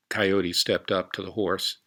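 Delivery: usual speaking voice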